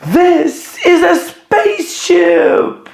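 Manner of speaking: high, slow, thrilled announcement; excited reading with a laugh. Shown as a demonstration. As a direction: sound amazed and delighted. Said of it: "read like you are excited".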